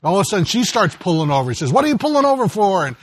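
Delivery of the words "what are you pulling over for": high-pitched acted irritation; upset husband. Upset husband questioning his wife at the wheel, irritated. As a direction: demand it, mock-upset, pitched up. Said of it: "he's getting angry, he's getting upset".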